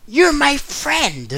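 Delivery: high, slow put-on anime voice; mock Naruto. Friend mocking a bad dub, high slow voice. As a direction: drawl it out like a cartoon hero. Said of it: "Naruto says".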